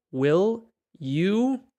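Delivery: teacher's rising stress on "you". Shown as a demonstration. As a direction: lift "you" up high.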